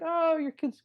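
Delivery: high-pitched adoring coo; gushing admiration. Retold or imitated. Admirers greet the parents about their adorable kids, gushing. Admirers cooing over the children.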